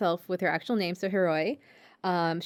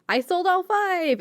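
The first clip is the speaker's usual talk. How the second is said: much higher, chirpy brag; perky bandmate; 11 semitones higher, 3 dB louder than usual. Retold or imitated. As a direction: show off brightly, way up high. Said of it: "bandmates are like".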